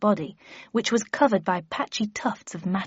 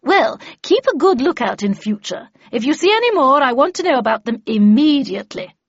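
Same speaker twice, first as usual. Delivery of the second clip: loud, higher, imperious order; stern raised voice, insisting; commanding; 5 semitones higher, 8 dB louder than usual. Acted. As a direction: call out firm orders to a guard. Havisham ordering the guard about.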